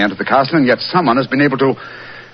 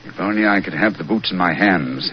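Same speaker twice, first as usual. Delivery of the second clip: ordinary talk, nothing marked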